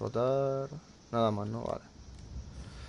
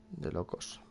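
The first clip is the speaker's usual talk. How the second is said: whisper; 5 semitones lower, 9 dB quieter, much slower than usual. Real, not acted. Repeats phrase, second whispered.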